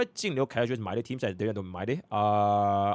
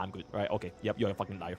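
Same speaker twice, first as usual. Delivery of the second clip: ordinary talk, nothing marked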